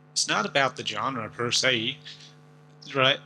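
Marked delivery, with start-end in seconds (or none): 0.1-2.0 Southern US accent. Shown as a demonstration. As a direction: demonstrate a drawling Southern accent. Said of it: "Down south".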